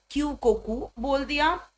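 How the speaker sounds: ordinary delivery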